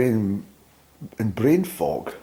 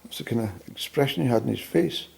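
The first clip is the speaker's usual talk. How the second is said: ordinary talk, nothing marked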